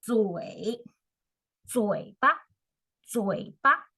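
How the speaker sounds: 嘴 dipping down then up, 巴 said light and short; teacher sounding out 嘴巴 slowly for students; 嘴 third tone dip then rise, 巴 unstressed; modelling the tones. Shown as a demonstration. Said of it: "go down first and then you go up".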